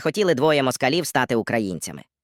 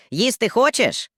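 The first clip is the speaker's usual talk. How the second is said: slow, higher mock Georgian voice; Georgian cellmate; 8 semitones higher, slower than usual. Georgian offers food to the Russian cellmate, slow mock voice. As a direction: draw out the offer slowly.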